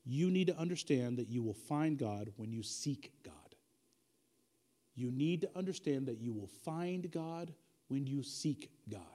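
slow, deliberate, low-pitched delivery; measured and low, with deliberate pauses; slowly. Real, not acted. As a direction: say it slowly so listeners can take notes. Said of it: "I'm gonna say them slowly".

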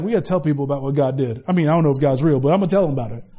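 lightly voiced nonbeliever; unbeliever. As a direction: shrug it off like a skeptic. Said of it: "people who don't love Jesus are like".

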